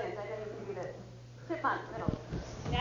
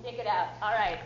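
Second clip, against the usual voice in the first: loud encouragement; 7 dB louder than usual. Real, not acted. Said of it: "coach urging group".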